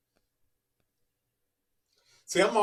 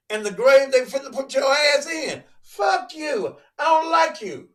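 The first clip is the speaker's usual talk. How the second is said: high, loud fired-up preacher; preaching shout; 6 semitones higher, 4 dB louder than usual. Acted. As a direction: shout it from the pulpit.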